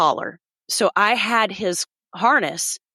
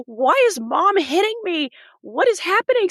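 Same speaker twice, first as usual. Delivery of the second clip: high, bewildered dog voice, pleading; the hurt dog asking why Mom hits him; voicing the dog; 9 semitones higher than usual. Dog wonders why Mom hits him, high and confused. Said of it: "So he's like".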